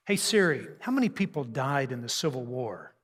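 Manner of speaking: usual speaking voice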